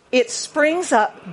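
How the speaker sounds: unhurried teaching read; slow explanation. Shown as a demonstration. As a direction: spell the sense out slowly. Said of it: "In the original language, here".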